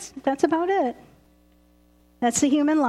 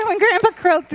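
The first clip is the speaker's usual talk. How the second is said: punchline repeated through laughter; real laughter; laughing; 5 semitones higher, 5 dB louder than usual. Real, not acted. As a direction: laugh while saying it.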